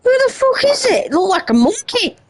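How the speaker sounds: high, startled and incredulous; shocked disbelief. Real, not acted. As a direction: react like something bizarre just ran past. Reacting to a weird figure.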